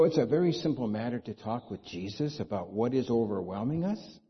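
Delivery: ordinary talk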